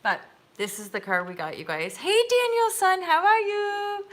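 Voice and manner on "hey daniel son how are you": excited greeting. Real, not acted. Greeting viewer Daniel in the live chat, high and delighted.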